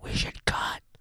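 hushed whispered reply. Acted. Visitor whispering to the doorman that he has the code. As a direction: breathe the answer secretly.

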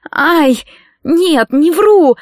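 high, drawn-out pained denial; acted moan of pain; moaning protest. A narrator's reading. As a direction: wail the denial, stretching it out. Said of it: "простонала Кэтрин".

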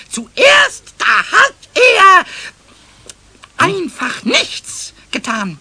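hoch und laut, gedehnt; aufgeregt dramatisch. Acted. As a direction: spannt die Pointe auf. Pumuckl erzählt dramatisch aufgeregt. (Pumuckl baut Spannung auf, hoch und gedehnt)

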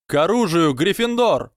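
high rallying call; battle cry. A narrator's reading. Gryffindors join the standoff, high battle cry. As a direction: cry out high to rouse your house. Rival house calls to arms.

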